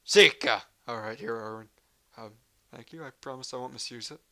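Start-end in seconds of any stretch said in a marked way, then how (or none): 0.1-0.6 barked word. Acted. Loud sergeant bark.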